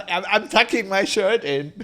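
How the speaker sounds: a hint of a mock politician; half-hearted impression, breaking into laughter; Rudy Giuliani. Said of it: "Do a Giuliani".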